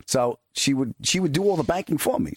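usual speaking voice

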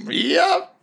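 high, squeaked little answer; chipper confirmation. Acted. Boots answering old crewmates, chipper. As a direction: pipe it up very high. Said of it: "And he's like".

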